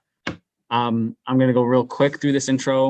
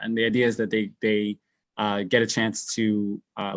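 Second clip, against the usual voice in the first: usual speaking voice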